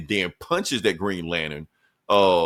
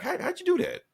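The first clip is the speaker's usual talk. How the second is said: much higher, a stunned little squeak of a question; amazed squeak; 5 dB quieter than usual. Retold or imitated. A hero baffled that his friend healed instantly, squeaking in surprise. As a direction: gasp it out like you can't believe the healing.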